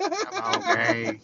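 put-on high, very slow drawn-out Old Greg. Quoting the Mighty Boosh character, slow high Old Greg. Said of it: "start calling you old Greg".

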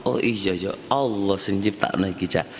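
hushed, humble dawning understanding; quiet realization. Retold or imitated. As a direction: say it to yourself as it sinks in. Voicing a calmed listener's realization.

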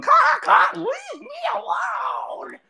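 very high, screechy bird voice; cartoon bird pleading in drawn-out squawks; squawking bird. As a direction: squawk it like a comical bird, stretching the end. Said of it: "you can kind of hear the bird going".

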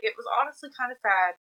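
ordinary talk, nothing marked

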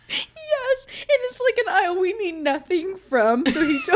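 high, squealing laughing speech; helpless laughter. Real, not acted. Telling co-host about the crop-dusted aisle, helpless laughter. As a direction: crack up while trying to get it out.

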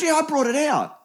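high, loud insisting waitress; lightly voiced stubborn waitress; insistent waitress.

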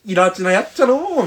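lightly hammed-up Kansai exclamation; mock exasperation. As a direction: play at grumbling in Kansai dialect. Repeats the acted dialect remark.

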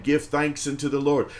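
normal talking voice

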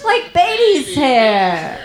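put-on very drawn-out British accent; thick British accent. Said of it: "I have a very thick accent".

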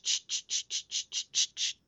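rhythmic unvoiced "ch" static; static noise imitation. Shown as a demonstration. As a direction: mimic radio static sweeping. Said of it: "all I'm hearing is the ch".

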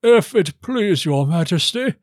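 slow, high courtier's voice; high, ceremonious baron. Acted. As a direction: address the king with drawn-out deference. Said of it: "said Baron Ingolf".

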